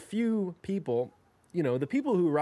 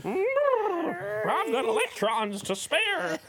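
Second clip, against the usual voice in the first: potassium character voice; 11 semitones higher than usual. Pretend. Hosts joking about reactive people, high silly voice. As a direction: play an unstable chemical bragging. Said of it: "He's like".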